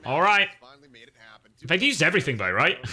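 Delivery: usual speaking voice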